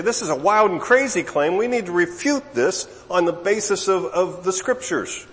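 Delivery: worked-up mock rebuttal; imagined indignant objection. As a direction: voice their objection, pitched well up and insistent. Imagined outraged reply of the scholars.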